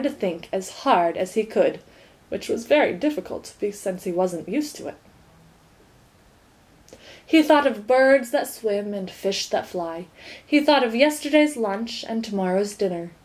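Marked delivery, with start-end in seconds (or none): none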